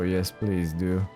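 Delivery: normal talking voice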